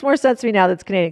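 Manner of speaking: ordinary delivery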